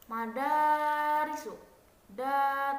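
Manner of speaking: Madarisu sung out slow, da stressed; stress on second syllable. Shown as a demonstration. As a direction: chant Madarisu, hitting da. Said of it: "suku kata kedua". (tekanan pada suku kata kedua, dinyanyikan)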